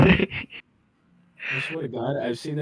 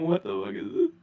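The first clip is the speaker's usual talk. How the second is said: laughing; 9 semitones higher than usual. Real, not acted. Laughing at voice activation.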